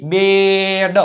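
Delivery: બેડ with a long held vowel and separate ડ; blending sounds. Shown as a demonstration. Teacher reading out બી ઈ ડી, બેડ stretched. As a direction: hold બેડ long and firm. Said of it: "બી ઈ ડી".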